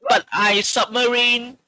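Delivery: high mock broken-English accent; Timur's English. Friend imitating Timur's English, high mock accent. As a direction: pitch up a hammy learner's accent. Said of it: "this is Timur's English".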